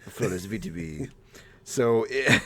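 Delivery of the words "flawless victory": announcer voice. Retold or imitated. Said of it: "We say all those things".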